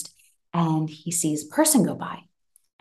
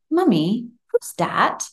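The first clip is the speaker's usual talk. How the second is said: put-on a little boy's high, curious question; small child asking; 6 semitones higher, slower than usual. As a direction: ask it like a toddler peering into the dark. Mum voices her small son.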